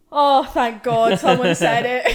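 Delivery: slow, loud, laughing relief. Retold or imitated. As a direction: laugh it out, finally vindicated. Retold relieved reply, laughing.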